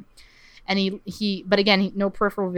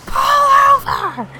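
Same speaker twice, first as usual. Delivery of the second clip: shrill, slow shriek; screaming; 7 dB louder, slower than usual. Retold or imitated. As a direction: scream it high and drawn out. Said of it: "just screams at Jim".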